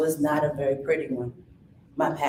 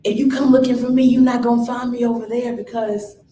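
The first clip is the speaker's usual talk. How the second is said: louder, higher declaration; announcing to old friends she has changed; firm stand; 6 semitones higher, 6 dB louder than usual. Retold or imitated. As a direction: stand your ground out loud.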